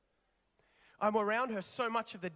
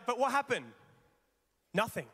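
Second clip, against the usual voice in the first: ordinary talk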